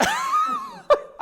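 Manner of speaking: very high squealing burst of laughter; real laugh. Real, not acted. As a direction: crack up at your own joke. Laughing at lock-in ick.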